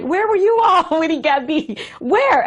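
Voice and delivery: high-pitched indignant questioning; retold upset demand. Wife retelling how she confronted his friends, upset. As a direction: re-enact demanding answers, voice shooting up.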